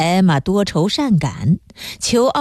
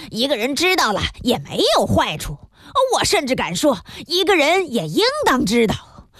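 garrulous, higher-pitched lecturing; nagging elder; 6 semitones higher than usual. A narrator's reading. Pharmacist advising the apprentice about the book, nagging like an elder. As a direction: ramble on like a fussy senior giving advice. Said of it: "像个长辈一样唠唠叨叨地说".

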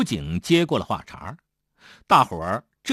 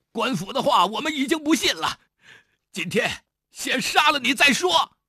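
higher, tense voice, fury about to boil over; enraged outburst; 10 semitones higher than usual. A narrator's reading. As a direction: spit it out in blind rage. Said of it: "大栓血红着眼睛说". (愤怒地、声音拔高)

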